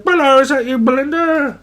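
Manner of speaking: a squeaky caller asking for Belinda; mock phone greeting. Put-on caller voice.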